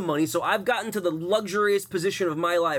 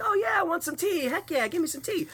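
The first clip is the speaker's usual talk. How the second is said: much higher, eager mock yes to tea; eager guest; 14 semitones higher than usual. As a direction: gush like someone dying for tea. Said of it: "they're like".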